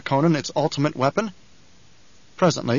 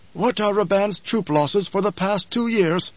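a higher, slow, probing mentat voice; Hawat; 7 semitones higher than usual. A narrator's reading. Hawat questioning the Baron about troop losses, measured. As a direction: press the question carefully.

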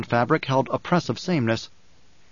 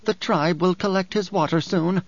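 higher character voice, controlled bitterness; Dory, bitter woman; 5 semitones higher than usual. Acted. Dory speaks of the sick man's water, bitterly. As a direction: lift the voice for an embittered woman.

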